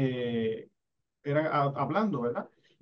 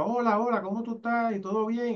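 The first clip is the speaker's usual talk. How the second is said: higher, over-articulated lip-reading greeting; exaggerated mouthing; 7 semitones higher than usual. Shown as a demonstration. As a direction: mouth every word big for a lip reader. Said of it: "Así con la boca".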